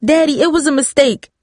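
high-pitched, urgent plea; pleading. A narrator's reading. Daughter begs father to let boy go, pleading. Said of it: "Darcy pleaded".

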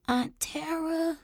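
put-on soft, slow, high little-girl voice; sleepy scratchy child. A narrator's reading. Sleepy child calls for her aunt, scratchy small voice. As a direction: call out drowsily, small and high. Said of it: "still scratchy from sleep".

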